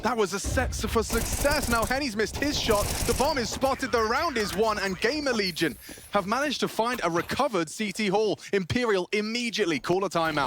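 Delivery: high, loud triumphant call; esports caster. Real, not acted. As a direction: ride the hype to the round end. Round won, caster still hyped.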